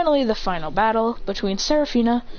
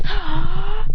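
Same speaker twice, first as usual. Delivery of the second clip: high, louder battle cry; charging yell; 7 semitones higher, 5 dB louder than usual. Acted. Clones run out of the smoke, high charging yell.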